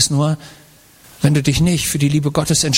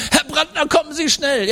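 put-on higher, breathless children calling; urgent kids; 9 semitones higher than usual. Classmates at the door urging the father, urgent child voices. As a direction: rush the father to come, urgent.

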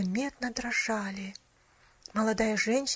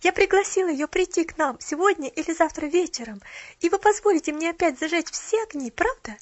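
put-on high, louder eager child voice; breathless chatter; 6 semitones higher, 6 dB louder than usual. A narrator's reading. Child asking to light the tree, chattering eagerly. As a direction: press on without stopping for breath. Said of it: "не переводя дыхания".

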